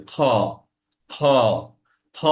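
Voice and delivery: খ scraped like clearing the throat; rasped খ. Shown as a demonstration. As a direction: rasp খ lightly inside the throat. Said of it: "ঠিক এরকম করে সাউন্ডটা হবে". (গলা পরিষ্কারের মতো কর্কশ খ)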